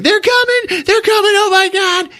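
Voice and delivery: very high hysterical squeal; panicked character. Retold or imitated. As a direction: shriek in hammed-up panic. Grease Monkey flees in panic.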